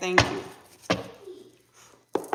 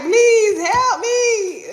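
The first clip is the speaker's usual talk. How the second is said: high, loud, drawn-out bawl; wailing plea; 13 semitones higher, 10 dB louder, much slower than usual. Acted. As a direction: beg in a hammed-up crying scream. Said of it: "Now somebody like".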